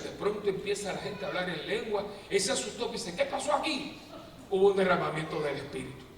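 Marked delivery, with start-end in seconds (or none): 3.1-4.0 high surprised question; startled pastor. Retold or imitated. Frightened pastor seeing tongues, high surprise.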